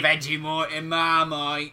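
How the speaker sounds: drawn-out, slow Australian vowels; mock Australian accent. Podcaster continuing the fake sponsor read, mock Australian accent. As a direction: stretch it out in broad Aussie. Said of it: "say it in an Australian accent".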